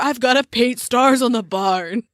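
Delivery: mock teenage angst with an accent; moody emo teenager. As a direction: sulk at mom like an emo teen. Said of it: "my moody nineteen hundreds teenager emo boy voice".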